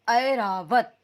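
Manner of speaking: Airavat slow and loud; slow clear reading. Shown as a demonstration. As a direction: read Airavat slowly for class. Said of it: "Airavat हाथी को कहते हैं". (धीरे और ज़ोर से ऐरावत)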